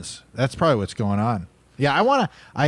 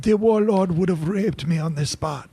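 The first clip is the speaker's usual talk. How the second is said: melodramatic victim voice; 5 semitones higher than usual. Acted. Same victim voice continues.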